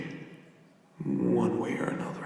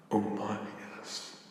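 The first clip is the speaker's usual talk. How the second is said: whispered; 11 dB quieter than usual. Acted. Giant answers tiny visitor's question.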